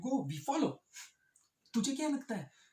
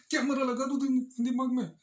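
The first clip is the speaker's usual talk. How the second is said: raised, high-pitched mock fury; exasperated shout act; 5 semitones higher, 7 dB louder than usual. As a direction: yell it back, completely fed up. Acts man exploding at question. (खीझ भरी चीख का अभिनय)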